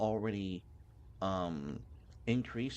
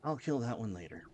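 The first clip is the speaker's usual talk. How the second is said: ordinary delivery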